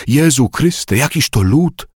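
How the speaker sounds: mock dying cry. A narrator's reading. Gaudenty retelling the dying bishop's words, mock cry. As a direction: lift the pitch for a retold deathbed outcry. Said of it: "krzyczał w śmierci".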